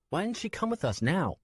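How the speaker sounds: put-on high young boy's voice, pleading; a small boy reminding the man; child voice. Nico to Swen, a high boy's voice.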